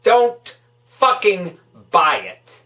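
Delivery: usual speaking voice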